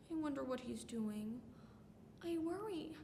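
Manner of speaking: very soft, anxious musing; quiet worry. Acted. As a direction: shrink to a small, worried voice. Worries about absent lover.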